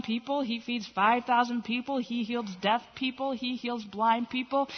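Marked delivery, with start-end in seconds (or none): none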